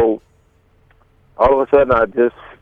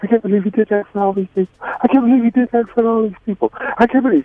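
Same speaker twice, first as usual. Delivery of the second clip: higher and higher, faster as it builds; imitating her distraught repeating; 9 semitones higher, faster than usual. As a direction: retell her stunned disbelief, rising each time. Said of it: "she just kept saying".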